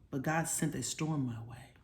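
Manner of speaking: ordinary delivery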